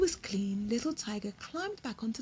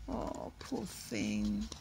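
much lower, soft and slow pity; sorry for him; 13 semitones lower, 6 dB quieter, much slower than usual. Real, not acted. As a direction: sigh over the poor little tiger. Sympathy with hurt tiger.

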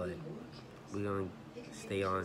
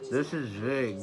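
mouth full; 2 semitones higher, 4 dB louder, slower than usual. Real, not acted. Mouth stuffed.

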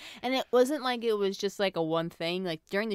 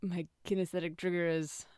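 ordinary talk, nothing marked

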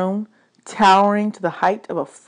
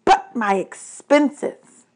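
complaining god, mostly pitched up; exasperated god of the dead; 4 semitones higher, 3 dB louder than usual. Acted. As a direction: list grievances like an overburdened ruler.

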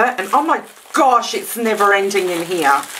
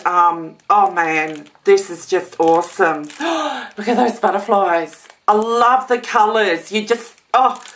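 normal talking voice

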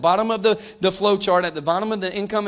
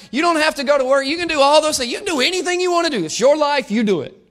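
mock permission; 9 semitones higher, 4 dB louder than usual. Mock agreement with reckless choices.

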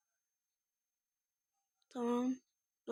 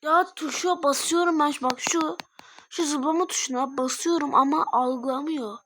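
frustrated complaint; 2 semitones higher, 5 dB louder than usual. Real, not acted. Player yelling the jump button isn't registering, frustrated. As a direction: complain hotly that the button fails.

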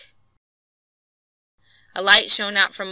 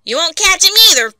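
higher, louder quick warning; loud fast retort; 11 semitones higher, 9 dB louder, faster than usual. A narrator's reading. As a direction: snap it out fast. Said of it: "said Bates".

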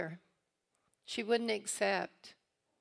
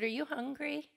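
higher, light matter-of-fact "hungry?"; plain motherly question; 8 semitones higher than usual. Retold or imitated. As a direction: ask it like nothing happened. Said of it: "She said".

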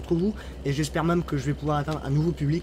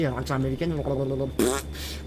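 mock American accent, babbled; half-hearted go at American English; attempted American accent; 2 semitones lower, much slower than usual. Shown as a demonstration. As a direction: give up on a bad American accent with a raspberry. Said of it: "même si j'essaie de le faire".

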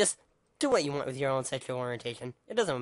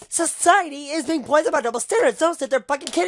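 much higher, louder furious outburst; angry shout; 10 semitones higher, 6 dB louder than usual. Real, not acted. Ranting vlogger shouts at viewers about double standards. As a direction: yell it in real outrage.

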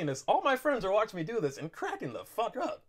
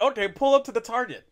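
louder, higher, losing patience; impatient raised voice; 6 semitones higher, 8 dB louder than usual. Retold or imitated. Impatient on the phone, loud high.

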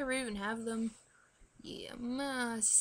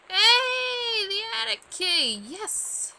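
high, loud happy cry; excited find; 10 semitones higher, 10 dB louder, much slower than usual. Real, not acted. Gamer spotting the attic key, excitedly crying out. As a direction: pounce on it with delight.

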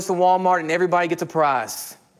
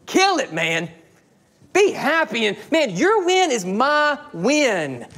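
higher excited cheer for a friend; mock cheering friend; 6 semitones higher, slower than usual. Preacher playing a friend cheering on David, higher and excited. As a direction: hype your friend up. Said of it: "when David goes on vacation".

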